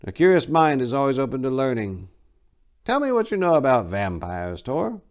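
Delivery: a curious, probing teacher; abbot's voice. Acted. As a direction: abbot questioning a young warrior.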